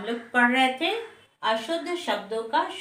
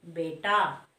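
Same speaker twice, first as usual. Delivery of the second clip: normal talking voice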